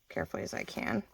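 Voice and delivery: breathy whisper, barely any voice. Real, not acted. Whispering to herself while gluing the corners. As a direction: whisper it while working very carefully.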